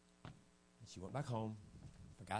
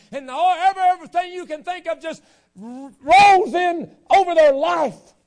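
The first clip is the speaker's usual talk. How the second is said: much higher and louder, rising to a shouted climax; preaching at full force; 13 semitones higher, 22 dB louder than usual. Acted. Preacher warning the congregation about bitterness, full-force shouting. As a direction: preach it hard, building to a roar.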